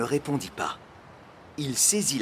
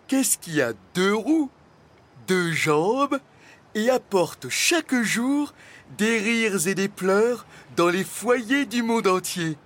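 raised, deliberate riddle-telling; dragon quizmaster, posing a riddle; 8 semitones higher, 4 dB louder than usual. A narrator's reading. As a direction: pose it phrase by phrase, pitched up. (dragon qui pose sa devinette, voix haute)

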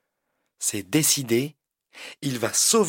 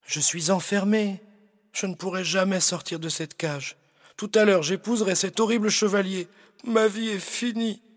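tearful; 7 semitones higher than usual. Acted. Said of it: "répond en pleurant".

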